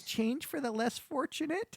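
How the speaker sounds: put-on high, soft, slow old-lady plea. Old lady begging the devil, frail put-on voice. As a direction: put on a little old beggar woman's voice. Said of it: "this really old-looking lady".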